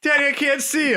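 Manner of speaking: put-on high, loud little kid whine; be the kid on the shore; child voice. Small boy calling to his dad, high and loud.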